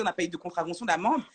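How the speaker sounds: ordinary talk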